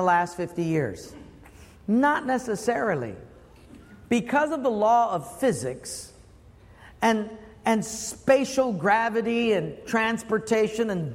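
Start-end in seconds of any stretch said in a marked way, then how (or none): none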